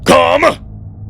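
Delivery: sharp shouted order; barked command. Acted. Cato summoning drones, a shouted order. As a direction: call the drones in hard.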